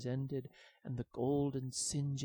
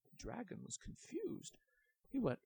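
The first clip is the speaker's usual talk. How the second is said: ordinary delivery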